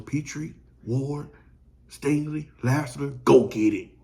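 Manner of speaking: loud rallying call to each defender; calling out players. Acted. Fan rallies the Texans secondary to grab interceptions, loud call. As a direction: bark their names like a coach on the sideline.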